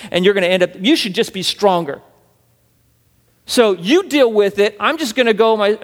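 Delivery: mocking a smug churchgoer defending his freedom; defiant selfish believer. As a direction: play the proud man who won't yield his rights.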